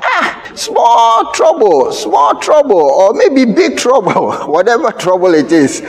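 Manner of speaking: high, urgent preacher's cry; preaching. Acted. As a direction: preach it out with rising fire. Preacher at full force.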